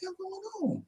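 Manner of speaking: sudden high squeak of disbelief; teacher's shocked reaction. Retold or imitated. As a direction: play the stunned teacher, pitch jumping way up. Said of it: "And his reaction like".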